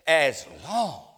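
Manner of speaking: loud, slow, high spaced-out scolding; captain up in his face, slow and furious; angry captain. Retold or imitated. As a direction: hammer each word into his face. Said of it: "And he said to me".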